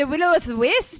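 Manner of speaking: imitated Scottish movie line; Scottish accent. Shown as a demonstration. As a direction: show off a Scottish burr. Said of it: "you gotta do it for the people".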